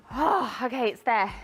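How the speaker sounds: usual speaking voice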